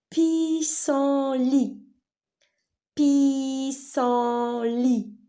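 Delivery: PIS-SEN-LIT, high, loud, long syllables. Shown as a demonstration. Teacher giving pupils a new word, syllable by syllable. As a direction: hold each syllable of "pissenlit" on a high note.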